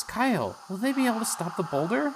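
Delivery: anxious question, voice up high; worried Dora. Acted. Dora asking Kyle about the boulder, high worried voice. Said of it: "And Dora says".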